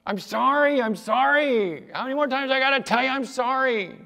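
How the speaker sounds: put-on high, loud whiny kid's apology; exasperated child. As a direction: protest like a child fed up with apologizing. Heard those words from your children.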